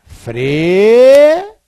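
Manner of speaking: "Frear" said loud and high with a long rising pull; model pronunciation. Shown as a demonstration. Teacher to students, modelling frear aloud. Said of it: "Vamos pegar o verbo frear".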